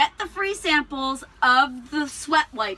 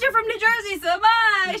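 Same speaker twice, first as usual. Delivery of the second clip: a touch higher, friendly phone voice; wife on the phone to the narrator, lightly higher and friendly; lightly voiced wife; 9 semitones higher, 6 dB louder than usual. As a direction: chirp it like a pleasant stranger.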